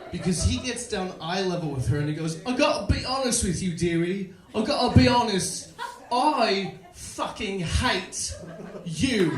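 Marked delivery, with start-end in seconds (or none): 2.4-9.3 put-on loud, higher Manchester accent, slowing at the end. Manchester buddy levels with the old lady, loud Manchester accent. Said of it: "My buddy's from Manchester, England".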